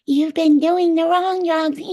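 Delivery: high, loud, drawn-out munchkin taunt; shroom munchkin. Retold or imitated. Shroom guys making fun of the tripper, high munchkin voice. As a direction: sing it out like a tiny mocking creature. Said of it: "They were like shroom munchkins".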